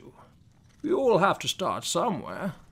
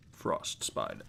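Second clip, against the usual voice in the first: deep hiss; 9 dB quieter than usual. Acted. Offended spider correcting interviewer.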